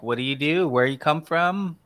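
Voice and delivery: normal talking voice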